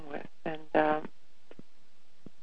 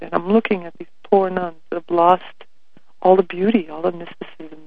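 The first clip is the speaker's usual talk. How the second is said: ordinary delivery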